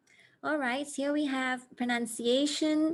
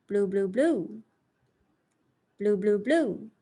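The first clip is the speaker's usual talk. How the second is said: Blue, blue, blue with one louder beat; stress pattern with blue; 4 dB louder than usual. Shown as a demonstration. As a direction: tap out the rhythm on blue. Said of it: "diamonds of the word stress".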